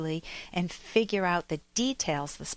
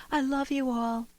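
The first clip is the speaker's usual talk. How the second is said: higher, showy "I love you all"; award speech gush; 6 semitones higher than usual. Shown as a demonstration. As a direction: gush it to the whole room. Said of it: "contrast that to somebody receiving an award".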